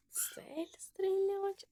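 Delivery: slow whispered question. Real, not acted. Player whispers a question to himself, slow whisper. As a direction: whisper it slowly.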